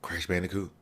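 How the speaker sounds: very soft, low hush; barely-there, low-pitched murmur; hushed anticipation. Retold or imitated. Hushed anticipation before an excited outburst.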